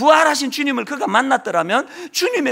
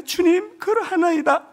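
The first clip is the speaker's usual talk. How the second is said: higher, pleading answer of Peter; voicing Peter; 7 semitones higher than usual. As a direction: answer up high like humbled Peter. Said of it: "이르되". (높은 목소리로 간절히 대답하는 베드로)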